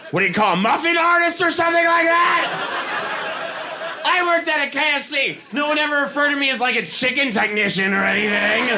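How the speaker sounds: high, indignant yelling rant; angry shouting. Retold or imitated. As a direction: yell it, outraged at the wording. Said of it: "then I was angry about a whole new thing".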